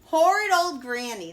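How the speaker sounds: loud growled curse; growling. A narrator's reading. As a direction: growl it, nursing his nose. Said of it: "the wolf growled".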